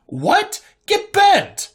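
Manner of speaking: squeaky high outraged yelp. Acted. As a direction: yelp it in indignant shock. Sans protests suit takeover.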